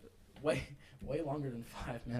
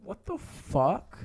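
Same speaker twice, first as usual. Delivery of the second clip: slow, drawn-out what the fuck, pitched up and louder; stunned disbelief; 6 semitones higher, 7 dB louder, much slower than usual. Real, not acted. Friend hearing the ghost story, stunned and drawn out. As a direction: let the shock stretch every word.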